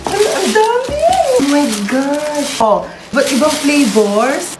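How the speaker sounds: high, slow, stretched-out thrill; excited overjoyed gasp; delighted gush. Acted. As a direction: swoon over the pile of treats. Seeing lots of cheesecake.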